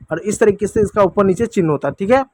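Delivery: ordinary talk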